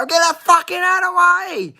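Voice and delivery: very high-pitched mock yell; hammed-up exasperation, pitch shooting up; retold exasperated outburst. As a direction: re-enact fed-up yelling in a strained high voice.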